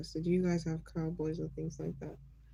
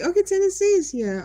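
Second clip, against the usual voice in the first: much higher and louder, thrilled; light up as a new state arrives; excited; 12 semitones higher, 11 dB louder than usual. Real, not acted. Streamer excitedly welcoming a Tennessee viewer.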